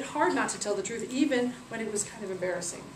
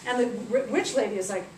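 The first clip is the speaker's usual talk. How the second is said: ordinary delivery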